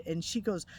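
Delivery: normal talking voice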